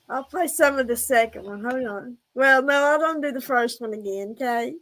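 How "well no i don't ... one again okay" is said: ordinary talk, nothing marked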